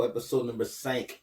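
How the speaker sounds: cinq said the French way, one nasal syllable, sank; French number. Shown as a demonstration. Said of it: "That's how you say it in French".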